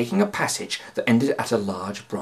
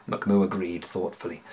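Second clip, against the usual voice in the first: usual speaking voice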